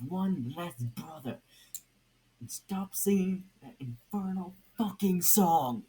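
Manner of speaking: high, loud furious warning; angry threat. Acted. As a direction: snap it out in rage.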